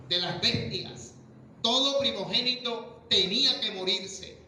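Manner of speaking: high, slow, rising preacher's build-up; preaching at full force. Acted. Pastor explaining the Passover to the church, preaching high and slow. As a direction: climb slowly to the last plague.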